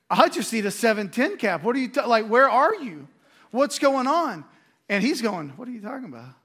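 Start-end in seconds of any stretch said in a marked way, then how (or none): 0.1-4.4 higher-pitched mock complaint to God; exasperated plea. Acted impatient plea to the Lord. 5.5-6.3 much softer, puzzled comeback; baffled reply. Acted. God's baffled reply, acted.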